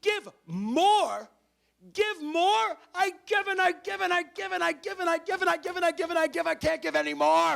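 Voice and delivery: high, shouted outrage at being asked; hammed-up frantic yelling, building in one breathless run; exasperated protest. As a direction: wail it loudly like someone pushed past their limit. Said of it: "And you're saying".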